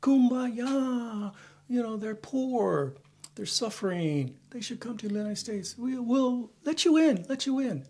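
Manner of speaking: high, soft mock welcome in a sing-song voice; mocking naive liberal. Mocking naive liberals who welcome everyone, high mock voice. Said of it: "They say".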